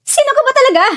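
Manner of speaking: high, agitated demand; shouting. Acted. Captive demands to know who the captor is, shouting. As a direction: cry out the question in alarm. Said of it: "Huwag ka nang sumigaw".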